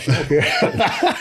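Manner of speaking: high, slow words broken by laughter; laughing. Real, not acted. Telling a friend about his tough wife, laughing. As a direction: laugh it out in disbelief.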